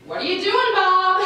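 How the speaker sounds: high, loud cry of alarm; loud, high-pitched startled question; alarmed protest. Acted. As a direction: wail it at him in comic panic. Acted alarm at being undressed.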